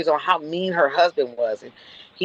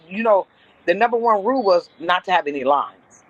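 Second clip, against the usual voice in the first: usual speaking voice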